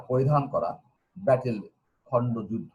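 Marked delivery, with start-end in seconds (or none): none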